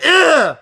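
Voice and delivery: loud high-pitched exasperated grunt; angry "ugh". Retold or imitated. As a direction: imitate a customer storming off. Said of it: "he got pissed and just stormed off".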